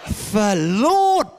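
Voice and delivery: hammed-up little boy exclaiming; son's voice. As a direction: swoop up on the long word, playing the child. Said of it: "As though he's gonna go".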